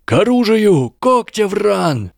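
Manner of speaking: high battle cry, house name stretched; rally your house to arms; shouted cry. A narrator's reading. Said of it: "выкрикнул Майкл Корнер". (выкрикнуть клич)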